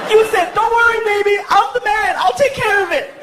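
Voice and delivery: very high mock-female whine; mocking girlfriend. Girlfriend quotes boyfriend's promise at him, high mocking voice. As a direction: throw his words back in a high voice. Girlfriend throws his words back.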